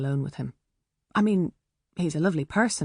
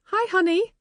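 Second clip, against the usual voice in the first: much higher, bright mum voice; doting high hello; high sweet mum greeting; 13 semitones higher, 3 dB louder than usual. A narrator's reading.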